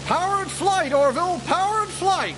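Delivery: high, loud jubilant shout; ecstatic cry. Acted. Wolver rejoicing to Orville over powered flight, ecstatic. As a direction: crow with triumph over the first flight. Wright brother celebrating first flight.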